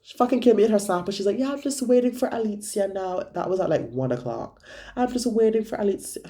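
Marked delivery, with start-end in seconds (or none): 1.4-3.2 higher, sing-song mock of her friend; mocking Maddie. Mocking Maddie's snap message. 4.9-6.2 higher, sing-song mock repeated; mocking Maddie again. Repeats Maddie's snap message.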